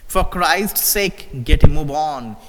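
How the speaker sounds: slow then loud, urging the driver on; for Christ's sake drawn out, then get a move on barked loud; urgent plea. A narrator's reading. Officer pleading with the driver to hurry, urgent loud.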